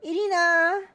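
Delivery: high, loud drawn-out call; piercing motherly shout; mother yelling. Acted. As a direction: yell the name down the phone. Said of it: "her familiar voice yelling into my ear".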